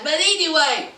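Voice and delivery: ordinary delivery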